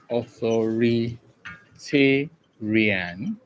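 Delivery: authoritarian drawn out slowly with gaps between syllables; slow, broken-up authoritarian for a class. Shown as a demonstration. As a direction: sound out authoritarian piece by piece, rising at the end. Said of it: "here is the appropriate syllabic division".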